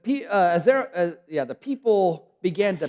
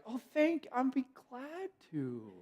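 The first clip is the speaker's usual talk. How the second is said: much higher, slow sugary agreement; mock cheerful yes to a coworker; fake politeness; 9 semitones higher, 10 dB quieter, slower than usual. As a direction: sound sweetly obliging while faking it. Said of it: "and you're like".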